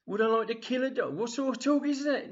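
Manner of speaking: high-pitched indignant grandad; acted scandalised old man; appalled outrage. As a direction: protest in shocked disbelief.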